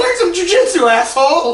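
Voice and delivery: loud, high-pitched mock yell; retold angry retort. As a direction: hurl it back as a mock insult. Retells shouting back at cop.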